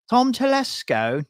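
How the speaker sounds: slow, high-pitched English accent on "Tom Telesco". Shown as a demonstration. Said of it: "say that with an English accent".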